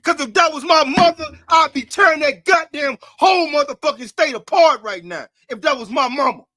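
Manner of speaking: raging at what they're supposed to do; furious outburst. Real, not acted. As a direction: let the outrage explode. Outraged rant at police killing.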